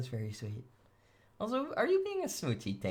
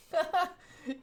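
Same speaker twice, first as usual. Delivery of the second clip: 1 evil laugh; 4 dB louder than usual. Acted.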